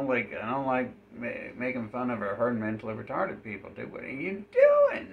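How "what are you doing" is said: very high, drawn-out disbelief; high squeaky what-are-you-doing; incredulous squeak. Real, not acted. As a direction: shriek it in disbelief. Exasperated outburst at the NPC.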